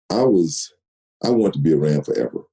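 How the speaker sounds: normal talking voice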